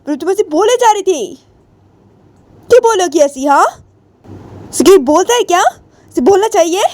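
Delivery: high, mounting indignant tirade; angry scolding. Acted. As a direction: keep scolding, pitch climbing. (गुस्से में ऊँची आवाज़ से डाँट)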